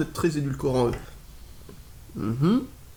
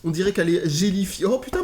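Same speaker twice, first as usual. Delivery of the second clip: usual speaking voice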